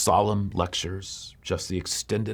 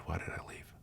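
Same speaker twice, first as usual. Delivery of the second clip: whispered, breaking under the question; despairing whisper; 3 semitones lower, 16 dB quieter than usual. Acted. Runaway girl to herself, a despairing whisper. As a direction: hush it almost to nothing, lost.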